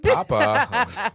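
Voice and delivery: high, loud laughing "Papa"; laughing kid call. Retold or imitated. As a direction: squeal "Papa" through laughter like a toddler. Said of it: "He's like".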